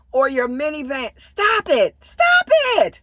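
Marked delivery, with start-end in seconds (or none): 1.2-2.0 raised, firm command; firm stop. Real, not acted. Vlogger scolding viewers who overshare, raised firm voice. 2.0-3.0 much higher and louder, fed up; exasperated shout. Real, not acted. Vlogger shouting at oversharing viewers, exasperated yell.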